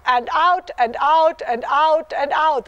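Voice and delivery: "out" stretched long and slow each time; spoken slowly and drawn out, like a long forward canter stride; slow drawn-out rhythm. Shown as a demonstration. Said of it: "want to go forward and canter".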